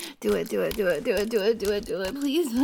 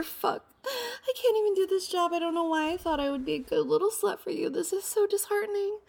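high, small, pouting little voice; pouty mock-dejected voice; sad pouty voice; 7 semitones higher than usual. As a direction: play it sulky and sad. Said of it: "You like that little sad and pouty voice?".

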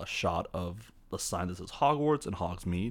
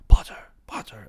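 loud stage-whispered taunt; Malfoy; 13 dB louder than usual. Retold or imitated. Schoolboy calling to Harry from behind, mock whisper. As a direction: call to a rival in a forceful whisper. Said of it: "behind them is Malfoy, who goes".

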